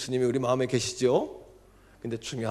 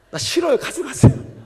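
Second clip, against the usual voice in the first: high, loud upset protest; angry crying refusal; 14 semitones higher, 12 dB louder than usual. Retold or imitated. Deaconess crying out to God, high and loud. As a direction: refuse the gift through tears, loud and high. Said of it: "너무 화딱지가 났대요".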